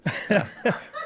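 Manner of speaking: short honking duck-call notes; duck call honks. Shown as a demonstration. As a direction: blow quick honks on the call. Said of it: "Mating call? Yeah".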